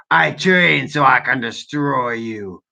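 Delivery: slow, low mock villain threat; low, hammed-up menace; mock menacing. Reading the troll's threat again.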